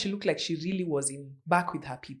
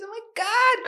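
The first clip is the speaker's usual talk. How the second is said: high, loud excited oh my God; real rush of excitement for Christmas; excited squeal; 3 dB louder than usual. Real, not acted. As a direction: squeal it with delight. Christmas is almost here.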